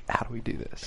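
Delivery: a near-whisper of baffled doubt; mock-hushed head-scratcher; hushed puzzled question. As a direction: breathe it like a conspirator. Team stumped over the format.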